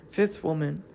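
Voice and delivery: ordinary delivery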